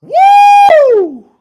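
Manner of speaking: ecstatic high laughing whoop; joyful whoop. Real, not acted. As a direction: let out a triumphant woo. Ecstatic whoop after preaching climax.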